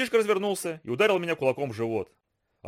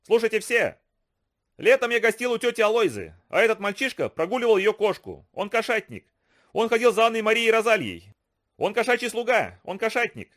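high mocking bully voice, lightly laughing; boy taunting in a raised, laughing voice; laughing shout; 8 semitones higher, 4 dB louder than usual. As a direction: jeer at him to the whole class, pitched up. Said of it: "крикнул, смеясь".

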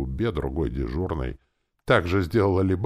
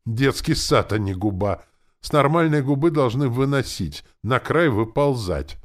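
loud, high-pitched griping; disgruntled, scornful grousing; grumbling; 8 semitones higher, 6 dB louder than usual. A narrator's reading. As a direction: complain indignantly at full voice. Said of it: "недовольно брюзжа".

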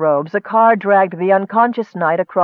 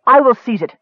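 loud, higher-pitched resolve; forceful declaration; 5 semitones higher, 5 dB louder than usual. A narrator's reading. Nicole volunteering to seize the dagger, forceful. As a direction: cut in loudly and firmly.

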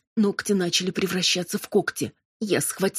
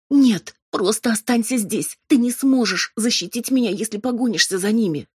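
higher, louder pleading; urgent plea; 5 semitones higher, 5 dB louder than usual. A narrator's reading. Robin begging her demon to stay, urgent and high. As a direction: beg him urgently to stay.

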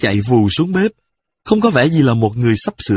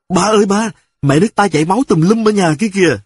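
put-on high, fast child yelling for dad; child shouting; 8 semitones higher, faster than usual. A narrator's reading. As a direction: call out to dad in a panic, higher and faster. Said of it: "miệng la bài bãi".

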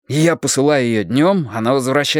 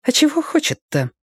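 put-on high small girl's voice; quiet little girl. A narrator's reading. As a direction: ask quietly like a child. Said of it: "негромко уточнила у меня".

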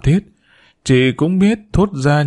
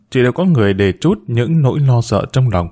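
usual speaking voice

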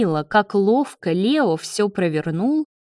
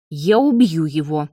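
restrained angry growl, lower pitch; growled threat; 4 semitones lower than usual. A narrator's reading. David threatens his rival, growling. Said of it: "прорычал Давид".

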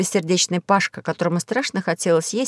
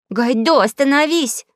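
higher, louder urgent cry; shouting; 7 semitones higher, 3 dB louder, slower than usual. A narrator's reading. Alisa shouting at the ship to stop. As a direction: yell at him to stop. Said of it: "закричала Алиса".

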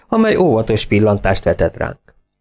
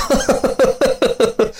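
booming laugh. A narrator's reading. Farmer roaring at his own joke, hearty guffaw. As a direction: burst out laughing. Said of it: "Harsogó nevetésbe tört ki".

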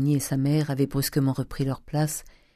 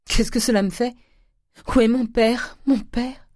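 high-pitched, desperate cry; anguished grief; 9 semitones higher, 4 dB louder than usual. Acted. Nephew caring only for his dead father, crying desperately. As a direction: plead wildly, voice pitched right up. Fils désespéré réclame son père.

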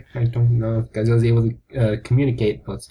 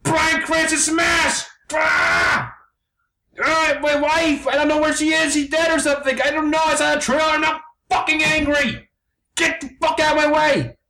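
much higher, monster shouting; turning into Godzilla; 12 semitones higher than usual. Acted. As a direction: roar it out as a raging beast-man. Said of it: "He's turning into Godzilla".

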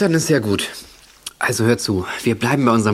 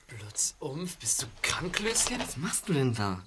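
quiet puzzled echo; 10 dB quieter, slower than usual. Acted. Puzzled friend, hushed.

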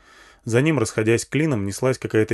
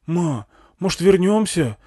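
put-on high kid's voice; boy asking his mum to turn back; tentative child, pitched well up; young boy; 8 semitones higher, 3 dB louder than usual. A narrator's reading. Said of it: "просунулся между спинками сидений пацан".

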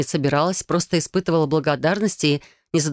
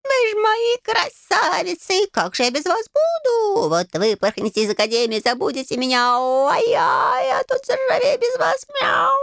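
very high, hammed-up caterwaul; wailing cat handle; slower than usual. A narrator's reading. Door-handle cat lamenting the girls leaving, wailing. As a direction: yowl tragically like a metal cat, drawing out words. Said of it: "завопила она".

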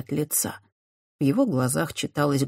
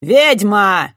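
loud, higher accusing shout; 5 semitones higher, 9 dB louder than usual. A narrator's reading. Knight shouting at Christiana, loud accusation. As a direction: bellow the accusation. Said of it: "громко выкрикнул один из рыцарей".

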